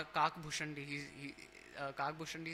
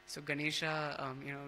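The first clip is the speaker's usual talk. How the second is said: ordinary talk, nothing marked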